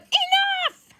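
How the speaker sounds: high, loud mock yell; hammed-up exploding "enough"; Ben fed up. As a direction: act out someone who has finally had it. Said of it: "Ben's had it now".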